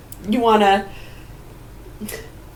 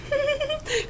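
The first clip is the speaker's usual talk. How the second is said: stifled laugh; 11 dB quieter than usual. Pretend. Muffled laugh demo.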